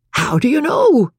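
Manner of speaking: high-pitched sharp challenge; demanding. A narrator's reading. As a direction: demand an answer, voice jumping up. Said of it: "demanded Doc".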